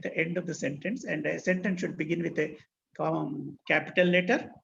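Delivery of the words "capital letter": ordinary delivery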